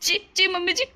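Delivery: normal talking voice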